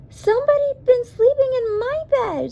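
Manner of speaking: very high, loud little squeak of outrage; squeaky Baby Bear. A narrator's reading. Baby Bear finds his bed slept in, high squeaky voice. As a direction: squeak it out in a tiny bear's voice. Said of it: "squeaked Baby Bear".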